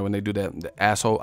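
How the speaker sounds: ordinary delivery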